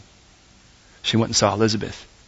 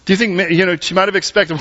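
ordinary talk, nothing marked

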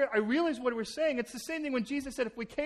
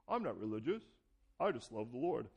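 pat churchy answer; 10 semitones lower, 5 dB quieter than usual. Retold or imitated. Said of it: "your pat answer".

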